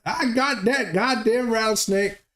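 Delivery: mock Texan brag. Butterstarch telling the neighbor about the snake in a high put-on Texan voice. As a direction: brag like a cartoon cowboy. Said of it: "Butterstarch is like".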